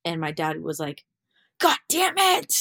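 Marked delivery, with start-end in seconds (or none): 1.6-2.5 high, loud furious curse; a father cursing loudly at bad news; dad's angry outburst. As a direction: explode like a disappointed dad. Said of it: "he was so mad".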